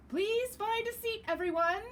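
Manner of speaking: bright teacher gathering the room; teacher calling out. Acted. Teacher Miss Pear calls the class to sit, high and loud.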